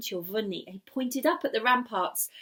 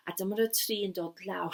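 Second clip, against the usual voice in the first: ordinary delivery, nothing marked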